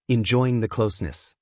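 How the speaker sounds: plain reading voice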